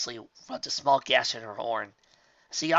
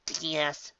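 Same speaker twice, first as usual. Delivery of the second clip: ordinary delivery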